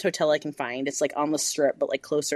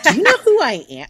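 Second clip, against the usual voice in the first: high indignant demand; mock outraged yell; mock outrage; 10 semitones higher, 8 dB louder than usual. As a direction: play up the offended diva. Angry retold phone call with husband.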